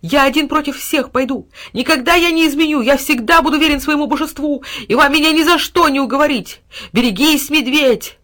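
high, impassioned vow; defiant outcry. A narrator's reading. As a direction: cry out your loyalty against all. Dog defies all enemies in fight.

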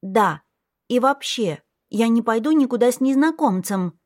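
put-on high child voice, digging in; stubborn little girl. A narrator's reading. Little girl refuses to go with a stranger, high and stubborn.

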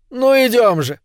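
a jolly tug along the quay; cheerful. A narrator's reading. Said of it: "весело сказал Ангмарк".

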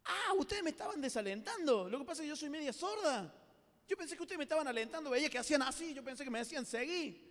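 high-pitched innocent realisation; sorpresa inocente. Acted. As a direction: explain the misunderstanding in surprise. Half-deaf frog answers surprised. (sorpresa ingenua, voz más aguda)

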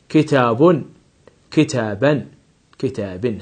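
"كتاب" as kitabun, "كتابا" as kitaban, "كتاب" as kitabin; nunation demo. Shown as a demonstration. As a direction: ring out each tanween ending. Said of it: "وأقول".

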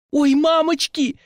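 high, slow frightened squeal; a scared teacher squealing; squealed in fright. A narrator's reading. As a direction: shriek and run away. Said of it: "взвизгнула она".

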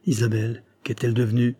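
ordinary delivery, nothing marked

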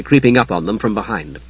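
ordinary delivery

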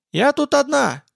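high, meek granny voice; old woman. Acted. Old woman answers the hunters.

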